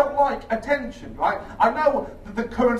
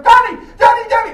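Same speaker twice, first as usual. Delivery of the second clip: high, loud calling out of a name; piercing call; 13 semitones higher, 12 dB louder than usual. Retold or imitated. Woman in the street calling out to the comic, high and loud. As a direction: yell the name across a busy street.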